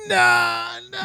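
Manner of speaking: put-on very high, loud toddler no; toddler refusal. Dad imitating his son refusing to clap. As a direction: shriek no like a stubborn two-year-old. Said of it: "And he was like".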